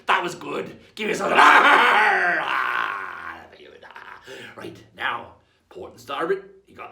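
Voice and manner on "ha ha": slow, loud laughing cheer; booming pirate laugh; proud pirate laugh. Shown as a demonstration. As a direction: reward them with a hearty laugh. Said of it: "laugh proud".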